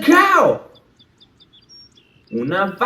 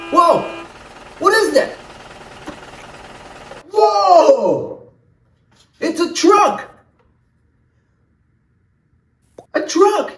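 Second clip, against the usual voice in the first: excited; 10 semitones higher, slower than usual. Acted. Host showing kids a truck with excitement. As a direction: gasp in delight at the truck.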